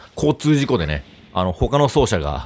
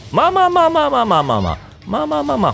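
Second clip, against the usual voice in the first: high-pitched soothing; 12 semitones higher than usual. Real, not acted. Calming down a charging monster.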